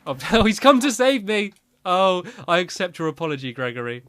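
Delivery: high, loud and amused; laughing. Real, not acted. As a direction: gush at the dog through laughter. Dog comes to rescue.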